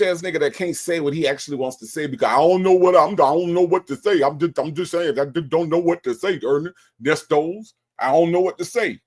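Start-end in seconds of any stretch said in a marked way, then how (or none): 2.3-9.0 high, whiny mock of a scared man. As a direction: imitate him nervously backpedaling, pitched up. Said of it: "you sound a little scared".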